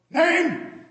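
loud, high desk sergeant call; sergeant barking a question. Acted. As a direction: call it over the station noise. Hearing aid at full blast.

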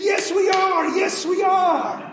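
high, loud yell, last line slowed; eager shouted reply. Acted. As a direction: shout the answer together, proud. Friends answer together shouting.

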